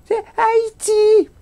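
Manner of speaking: very high, slow, hammed-up Haitian accent; bad Haitian accent. Fake nurse says the hospital is in Haiti, squeaky bad Haitian accent. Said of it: "accent poche haïtien".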